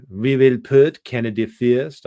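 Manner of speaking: slow, mocking Quebecois French accent impression of the politician. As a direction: drag out "We will put Canada first" in a hammed-up Quebecois accent. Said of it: "I said I wasn't gonna do an impression".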